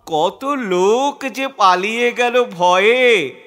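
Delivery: usual speaking voice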